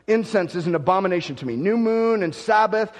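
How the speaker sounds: loud, declaiming Isaiah as God's complaint; forceful scripture reading. Acted. Preacher reading God's rebuke to the people, loud and declaiming. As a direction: proclaim it like a preacher at full force.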